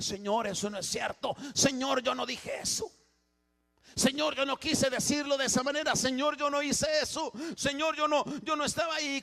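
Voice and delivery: pleading sinner. Acted. Sinner pleads excuses before the Lord.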